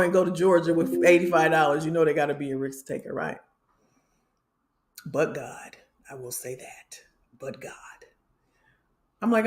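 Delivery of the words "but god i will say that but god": hushed unvoiced whisper, much softer. Real, not acted. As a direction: breathe it as a private thanks. Aside praising God.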